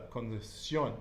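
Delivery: condición with a crisp s, never sh; Spanish s sound. Shown as a demonstration. Teacher demonstrating the s in condición. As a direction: say condición with s in place of sh. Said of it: "So the sh becomes a s".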